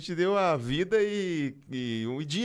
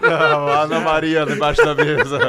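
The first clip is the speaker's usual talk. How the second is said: calling out; 2 semitones higher, 12 dB louder than usual. Real, not acted. Calling out to a dog.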